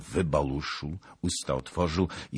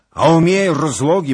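high, loud caution; urgent warning; 13 semitones higher, 15 dB louder than usual. A narrator's reading. Zagloba warns the rider about the route, urgent caution. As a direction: cut in with a raised-voice warning.

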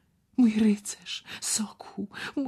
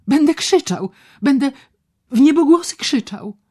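loud threat; 11 semitones higher, 11 dB louder than usual. Acted. Patient threatens to scream.

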